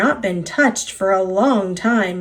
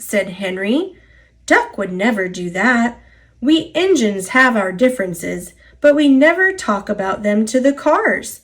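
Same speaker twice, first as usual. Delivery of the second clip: ordinary delivery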